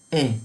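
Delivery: ای said like English A; ای, a single clear "ey" for Ä; German Ä sound. Shown as a demonstration. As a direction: say ای as the umlaut Ä, an "eh" sound.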